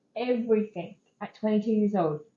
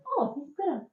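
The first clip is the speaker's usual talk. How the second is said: mild surprise; 7 semitones higher, 5 dB quieter than usual. Retold or imitated. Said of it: "you might go".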